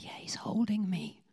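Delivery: hushed unvoiced whisper; tender, awed whisper. [name] tells the pastor Jesus holds him, whispered. [name] in prayer, eyes closed.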